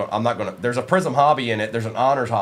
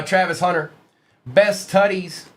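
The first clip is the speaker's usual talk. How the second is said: normal talking voice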